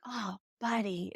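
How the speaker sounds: soft, slow commiseration; simple sympathy. Retold or imitated. Older son consoling his younger brother, soft sympathy. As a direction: sigh it out gently. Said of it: "just look and be like".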